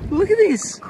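high-pitched squeal of delight; real delight, voice jumping way up; excited squeal. Real, not acted. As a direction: squeal while showing it off. Showing the new shell.

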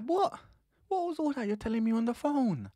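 high, indignant "what"; outraged disbelief at the phone promises; disbelieving. Retold or imitated. Disbelieving quote at girl.

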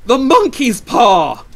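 high, slow, frantic shout; wild cry. A narrator's reading. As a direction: shout it wildly with drawn-out words. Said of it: "she cried wildly".

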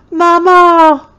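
put-on loud, high child's wail; a toddler wailing for her mother. A narrator's reading. Terrified toddler wailing for her mama, loud and high. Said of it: "began to wail".

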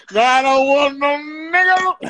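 high-pitched theatrical line reading; actor's first take. Acted. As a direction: declaim it high, like an actor on set. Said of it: "I didn't like the way I said".

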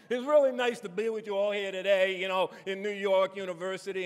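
loud, high Jersey accent; broad North Jersey street accent; New Jersey accent. Shown as a demonstration. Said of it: "So I grew up talking like this".

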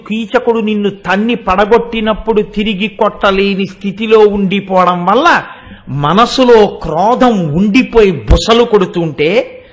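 ordinary talk, nothing marked

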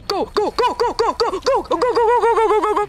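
high, rapid-fire chant; frantic urging. Real, not acted. Player rushing a game couple to safety, frantic urging. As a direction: urge them on in an excited high voice.

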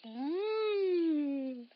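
a contented tasting "mm"; yummy mm. Acted. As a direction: hum over something tasty. Savoring food in story.

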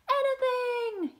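high, loud, drawn-out yell; excited yell. A narrator's reading. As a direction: yell it out, thrilled and stretched. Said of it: "yelled Kitty".

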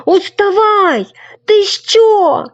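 high loud calls, drawn out; calling loudly. Acted. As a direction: call at the sleeper, loud and high. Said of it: "гукав Сашко Циган". (гучне високе гукання)

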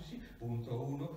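usual speaking voice

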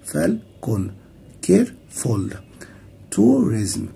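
"فالكون، كيرفول، توريزم، ايجيبت" each split in two; syllable by syllable. Shown as a demonstration. Teacher listing two-syllable words, syllable by syllable. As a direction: separate both syllables of "فالكون" and each word after it. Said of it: "كلمات ذات مقطعين".

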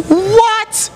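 put-on preacher to congregation, shocked that Noah got drunk; shocked outburst. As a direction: exclaim in mock outrage, stretching the words.

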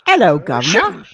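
hammed-up, badly faked Cockney greeting; terrible Cockney accent. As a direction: do a deliberately bad Cockney. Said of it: "Quite possibly the worst Cockney accent ever".

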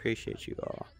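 soft whispered gratitude; whispered thanks. Real, not acted. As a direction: breathe the thanks quietly. Bedankt kijkers zacht.

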